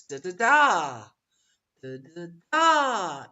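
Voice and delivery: 'da, da, da' as da-da-DAAA, loud long third; stress pattern demo. Shown as a demonstration. Teacher beating out the third syllable, da-da-DAAA pattern. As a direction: slowly chant 'da, da, da', booming on the last. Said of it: "So the third syllable".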